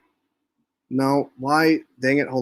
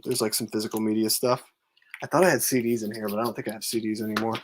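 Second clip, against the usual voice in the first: quiet at night; 2 semitones lower, 5 dB quieter than usual. Real, not acted. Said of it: "so I gotta be kinda quiet".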